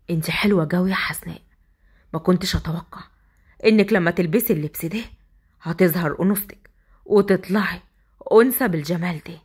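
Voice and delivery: whispering husband. Acted. Asad whispering to Hasnaa as he lifts her. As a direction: breathe the compliment close to her ear.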